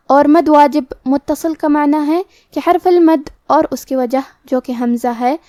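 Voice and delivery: ordinary delivery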